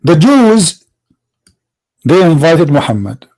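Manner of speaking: usual speaking voice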